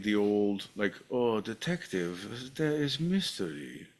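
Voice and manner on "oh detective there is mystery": drawn-out, soft detective act; slow start, then lift the pitch on the mystery; Sam Spade. Retold or imitated. Speaker imitating an old screen sleuth, old detective voice. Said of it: "it was like the old Sam Spade".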